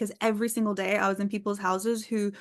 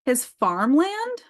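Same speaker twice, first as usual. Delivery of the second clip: slow, higher incredulous question; surprised; 4 semitones higher, 4 dB louder, much slower than usual. Real, not acted. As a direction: ask it slowly in disbelief. Surprised at the odd answer.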